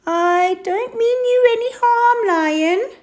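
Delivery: high-pitched pleading whine; whined. Acted. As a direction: whine it like a nervous hyena. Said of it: "she whined".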